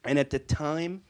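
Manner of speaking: normal talking voice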